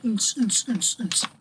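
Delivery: spoken dance-music thump; chanting beat noises; mouthed club beat. Pretend. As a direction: mimic a thumping beat in short pulses. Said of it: "Rapping to a sick beat".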